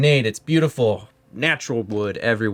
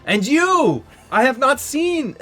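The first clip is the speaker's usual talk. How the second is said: high, amazed exclamation; single out a guest in high-pitched wonder; effusive mayor; 4 dB louder, slower than usual. Acted.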